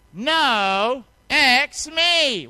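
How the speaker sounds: loud and high, leading the crowd; loud call-and-response. Shown as a demonstration. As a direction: shout it out with the congregation. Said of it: "Can you say that with me pretty loud?".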